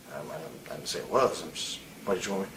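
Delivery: retold, hushed and hesitant, the voice shrinking away; meek quiet reply. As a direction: play it small, like backing down from a bully. Retelling his own timid reply.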